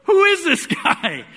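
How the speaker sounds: high, loud, slow marvel; amazed. Real, not acted. Preacher marvelling to the congregation about his father. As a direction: marvel aloud, drawn out and high.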